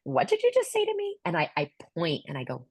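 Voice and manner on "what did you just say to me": high, fast, indignant disbelief; shocked. Retold or imitated. Partner stunned at being told to shut up, shocked. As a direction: fire it back, stunned and offended. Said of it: "she looks at me like".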